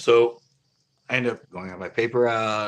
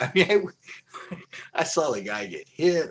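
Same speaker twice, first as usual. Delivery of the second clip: ordinary delivery, nothing marked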